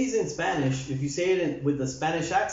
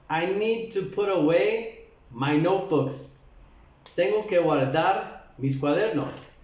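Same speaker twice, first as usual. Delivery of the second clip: ordinary talk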